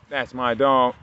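loud praise, normal pace; loud dog praise. Real, not acted. Owner praising his dog, loud and quick. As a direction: call it out proudly to the dog.

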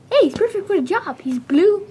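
usual speaking voice

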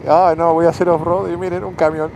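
imitándose con burla. Retold or imitated. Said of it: "Esta tontería".

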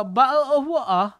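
glottal-stop British accent, every T dropped; hammed-up British "bottle of water" with no Ts; playful mock British accent. Shown as a demonstration. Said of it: "British accent yun tol eh".

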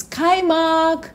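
usual speaking voice

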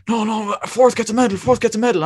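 high-pitched, excited imitation of a friend; retold excited insistence. Friend telling runner about the fourth-place medal, imitated excitement.

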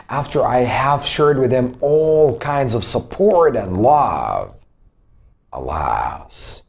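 acted lament, slow and sinking; mildly mournful, weighted delivery; deep sadness. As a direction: let it drop low and heavy by the end. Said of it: "used to show your deep sadness".

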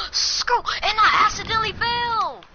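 high, drawn-out wail of dismay; long high whine of dismay. Real, not acted. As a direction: wail it like a disaster, high-pitched. Wailing after falling in game.

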